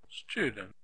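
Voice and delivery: students with STU strong and DENTS weak, falling; stress on first syllable. Shown as a demonstration. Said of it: "first syllable".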